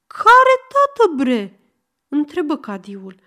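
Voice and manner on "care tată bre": baffled shout. Acted. Cadi asks, baffled.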